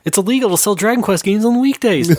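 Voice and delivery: high, put-on fan voice; mock gushing voice. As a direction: recite it like a starry-eyed fan. Mocking list item.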